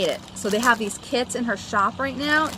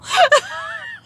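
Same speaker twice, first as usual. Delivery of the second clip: high shrieking burst of laughter; loud laugh; 7 dB louder than usual. Real, not acted. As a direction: let out a high squealing laugh. Laughing about hoarded keepers.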